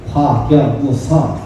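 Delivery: very slow, weighty delivery; slow solemn pronouncement. Retold or imitated. Bodhidharma answering the emperor, slow and solemn. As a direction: drag out each syllable like a koan. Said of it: "이렇게 얘기해요".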